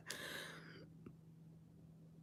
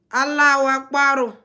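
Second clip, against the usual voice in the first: proud call; 5 semitones higher, 11 dB louder than usual. Retold or imitated. Relays spirit's proud salute.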